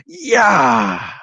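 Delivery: a high, loud wild battle cry; crazy yell. A narrator's reading. Boy fakes kung fu with a loud, high crazy yell. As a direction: yell it like a madman mid-kick. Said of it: "yelled like a crazy man".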